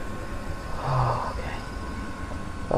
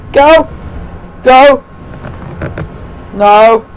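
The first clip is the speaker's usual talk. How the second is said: loud, high single-word yelps; tense high bursts; panicked urging; 10 semitones higher, 5 dB louder, much slower than usual. Real, not acted. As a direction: blurt it out, urgent and scared. Urging himself away from danger.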